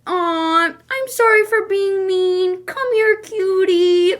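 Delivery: high, slow, syrupy mock apology; cutesy apology. Girl switching up to sweet-talk the guy, cutesy apology. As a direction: coo to win him back, hammed up. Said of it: "she switches up again and says".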